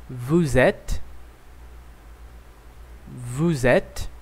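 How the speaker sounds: "Nous sommes", "Vous êtes", "Ils sont" said twice each, clear and measured; French drill model. Shown as a demonstration. Teacher drilling plural être forms, clear model reading. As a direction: model each form for the class. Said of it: "Vamos lá".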